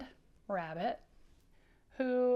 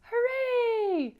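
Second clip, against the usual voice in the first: much higher, loud cheering "Hooray!"; excited cheer; 9 dB louder than usual. Shown as a demonstration. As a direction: cheer it out like a thrilled rabbit. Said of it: "Can you do it like him?".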